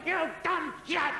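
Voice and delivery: high, strained yell of frustration; shouting. Acted. Caller losing patience with a deaf listener, shouting. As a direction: bellow it at someone who won't hear. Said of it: "There's no need to shout".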